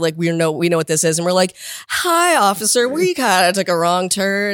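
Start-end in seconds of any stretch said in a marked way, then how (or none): 1.9-4.5 slow, drawn-out sheepish greeting; mock-innocent, stretching out the words; innocent plea. Driver greeting border officers after a wrong turn, innocent plea.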